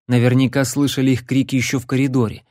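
ordinary delivery

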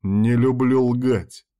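slow, firm cut-off; curt refusal. A narrator's reading. Man answers her plea not to talk so, curtly. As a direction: cut the plea off, drawing it out firmly. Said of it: "отрезал я".

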